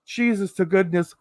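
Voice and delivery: ordinary talk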